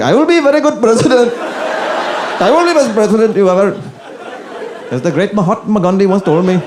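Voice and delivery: high-pitched, hammed-up Indian accent; mock politician pandering in an Indian accent; Kamala, Indian accent. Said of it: "speaking to a bunch of Indian people".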